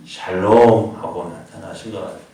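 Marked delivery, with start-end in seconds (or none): 0.1-0.9 Jesus greeting. Acted. Jesus greeting disciples, quoted.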